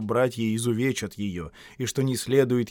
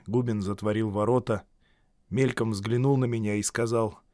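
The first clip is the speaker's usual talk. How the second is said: plain reading voice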